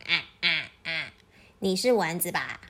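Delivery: hint of a dismissive goose; haughty goose. Goose looking down on a bee, mildly haughty.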